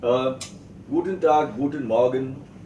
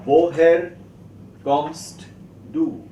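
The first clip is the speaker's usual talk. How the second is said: loud first word, clear gaps between words; emphatic, separated teaching delivery; word-by-word drill; 3 semitones higher, 7 dB louder, slower than usual. Shown as a demonstration. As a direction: break the question up for learners. Teacher breaks question into words.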